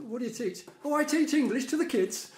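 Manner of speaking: Irish lilt, playing the German friend; mock Irish accent for the punchline. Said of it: "the most wonderful Irish accent".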